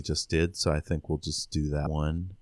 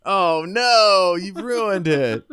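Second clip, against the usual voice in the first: much higher and louder, laughing fake dismay; mock outrage; 13 semitones higher, 7 dB louder, much slower than usual. Host to cohost after a spoiler, laughing mock outrage. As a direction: squeal a pretend complaint through laughter.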